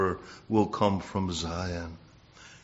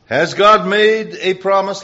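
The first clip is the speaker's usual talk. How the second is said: ringing repeat; 8 semitones higher, 9 dB louder than usual. Acted. Preacher repeats the key question.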